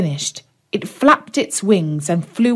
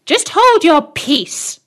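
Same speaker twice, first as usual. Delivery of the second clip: higher, slow, scornful crone; old hag; 9 semitones higher, 4 dB louder, slower than usual. Acted. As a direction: sneer it like a cruel old hag. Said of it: "said the old hag".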